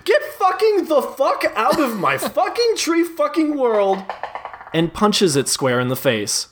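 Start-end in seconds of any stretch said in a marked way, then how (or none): 0.0-4.0 high, swearing monster yell; yelling blob. Acted. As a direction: keep screaming at the player as the blob. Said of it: "continues to yell at you".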